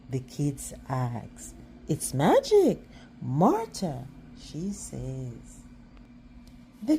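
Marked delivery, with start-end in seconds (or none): 1.9-4.1 excited. A narrator's reading. Teacher reveals magic glue.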